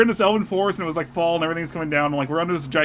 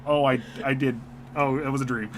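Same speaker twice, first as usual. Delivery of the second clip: sheepish realization; 5 semitones lower, 5 dB quieter than usual. Retold or imitated. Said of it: "I was like".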